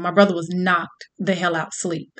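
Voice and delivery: plain reading voice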